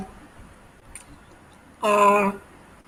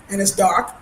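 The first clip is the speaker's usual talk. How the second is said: ordinary talk, nothing marked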